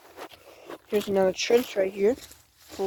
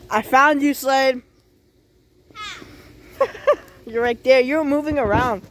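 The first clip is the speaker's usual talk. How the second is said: playful calling out; 4 semitones higher, 4 dB louder than usual. Real, not acted. Hide and seek, found him.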